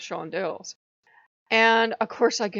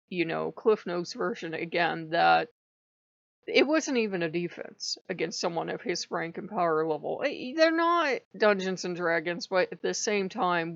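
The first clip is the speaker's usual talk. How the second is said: ordinary talk, nothing marked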